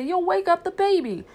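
high, loud scolding mimicry; mock fussing owner. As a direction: fuss loudly in a high mocking voice. Tramp mimics fussing humans.

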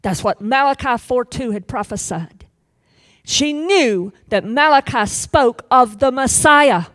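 ordinary talk, nothing marked

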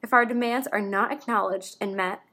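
emphatic, declamatory reading of a written complaint; formal petition read-aloud. Acted. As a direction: read it out like an official statement.

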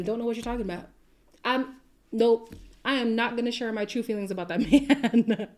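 ordinary talk

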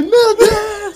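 very high, loud laughing shriek; laughing mock shriek, pitched very high; shocked cry. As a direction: squeal the dad's fright through laughter. Dad's seat falls back.

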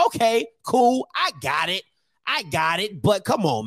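ordinary delivery

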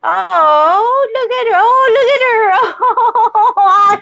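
high squealing coo breaking into a laugh; high delighted cooing with a laugh. Real, not acted. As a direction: gush over the dog, laughing with joy. Melting over dog on call.

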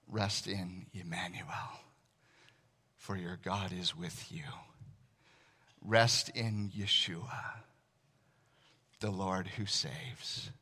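slow, hushed meditative litany; guided meditation. Acted. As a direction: breathe each line out gently. Whispered meditative rest litany.

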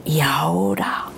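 eerie read-aloud with a sudden gasp; suspenseful reading. Shown as a demonstration. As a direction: build the fear like reading a scary tale.

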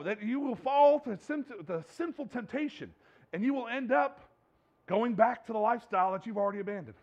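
loud, high, driving sermon voice; preaching at full force. Acted. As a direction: drive it home to the congregation.

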